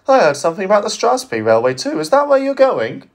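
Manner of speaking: high, fast nosy little diesel; quick and bright, fishing for news; chirpy diesel. Acted. Chirpy diesel questions Donald.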